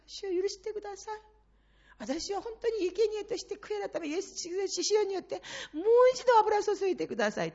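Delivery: 高い声で、最後は泣きながら懇願する; tearful pleading prayer. Shown as a demonstration. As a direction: beg for forgiveness, rising into tears. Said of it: "それがあなたたちの祈りなんです". (泣きながら赦しを乞う祈り)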